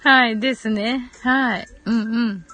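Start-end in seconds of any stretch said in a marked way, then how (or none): none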